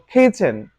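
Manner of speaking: "খেয়েছেন" with aspirated k; correct pronunciation. Shown as a demonstration. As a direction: puff the first sound of "খেয়েছেন". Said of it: "যেমন".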